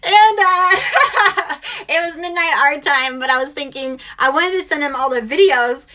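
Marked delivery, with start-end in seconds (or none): 0.8-1.8 loud laugh, high and rising. Real, not acted. Mom cracking up at her late-night text, loud high laugh.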